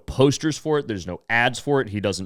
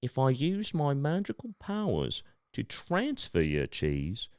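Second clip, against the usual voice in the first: slow, soft magician in a put-on accent; the performer's accent, hushed and deliberate; mock-mystical showman with a put-on accent; Cheese Man accent; 8 dB quieter, slower than usual. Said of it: "I gotta do his accent".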